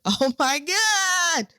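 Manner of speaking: high, drawn-out mock wail; loud, hammed-up crying outburst. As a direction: wail it out, imitating bursting into tears. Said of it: "I bust out crying".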